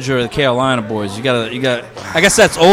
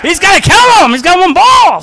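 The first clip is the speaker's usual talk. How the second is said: high, loud hyped-up imitation of local fans; excited Boston townsfolk; 11 semitones higher, 5 dB louder than usual. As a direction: rave like the whole town is hyped. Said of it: "all the town, he's like".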